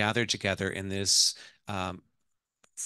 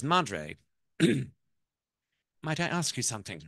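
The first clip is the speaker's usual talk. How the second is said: put-on higher-pitched wife in an English accent; 5 semitones higher than usual. Wife asks her mother-in-law a question in a dark room, English accent. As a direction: ask tentatively in an English accent. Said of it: "I'm gonna do the ... the accent".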